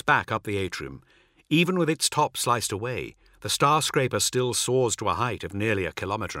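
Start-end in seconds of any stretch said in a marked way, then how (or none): none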